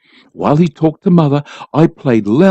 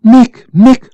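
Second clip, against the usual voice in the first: higher and louder, urgent cry; cried out; 9 semitones higher, 8 dB louder than usual. A narrator's reading. Mother crying out to stop father leaping at the child, loud and high. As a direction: stop him with his name. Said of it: "cried Mother".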